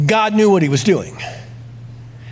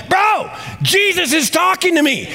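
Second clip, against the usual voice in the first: much higher, urgent and wide-eyed; frantic phone call; 13 semitones higher than usual. Pretend. Pastor calls Pastor George in a panic, high and frantic. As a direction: blurt it out to your pastor in a panic. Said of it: "I'm like".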